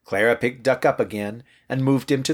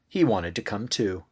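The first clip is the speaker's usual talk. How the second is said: plain reading voice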